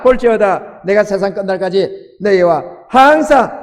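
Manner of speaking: loud preaching read of the verse; scripture proclamation. Acted. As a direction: proclaim the Great Commission with force. Proclaims Matthew 28 aloud.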